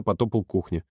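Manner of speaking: usual speaking voice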